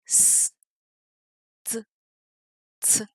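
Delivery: s, t, ts as bare consonant sounds; three separate sounds: hissed s, short t, ts; isolated consonants. Shown as a demonstration. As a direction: say s, t, ts one by one, no vowel. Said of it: "on va commencer avec trois consonnes".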